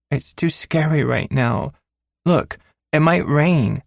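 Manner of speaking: put-on high child voice, stalling; a six-year-old putting it off; pouting little girl. Daughter begging her father to wait, high pouting child voice.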